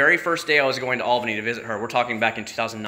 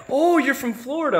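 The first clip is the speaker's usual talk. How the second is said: mock dumb surprise; 13 semitones higher than usual. Mocking the trooper with high, hammed-up surprise.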